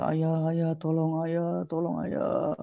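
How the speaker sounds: put-on high, pleading child's cry for help; crying little girl. Buried daughter begging her father Umar, pleading child voice. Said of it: "Itu anaknya nangis-nangis".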